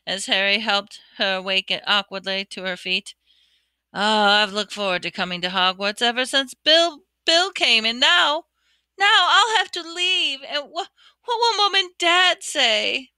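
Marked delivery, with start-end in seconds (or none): 3.9-13.1 high, breaking, tearful pleading; weeping. Acted. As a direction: let the voice climb and crack as she cries. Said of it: "Ginny wept".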